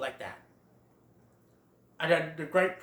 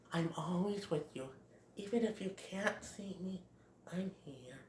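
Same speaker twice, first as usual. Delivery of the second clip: much softer gentle hush; tender mother; 14 dB quieter than usual. Retold or imitated. Bambi's mother in his dream, tender hush. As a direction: comfort him softly. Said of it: "his mom says that one line".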